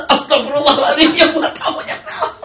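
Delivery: high, loud laughing cry; laughing dismay. Retold or imitated. Preacher laughing at the wrong food served, high and loud. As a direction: exclaim it laughing.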